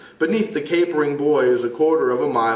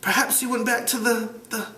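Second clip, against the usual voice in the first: ashamed mutter; 11 semitones higher than usual. Acted. Ralph answering Piggy, muttered in shame. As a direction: trail off ashamed. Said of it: "Ralph muttered the reply".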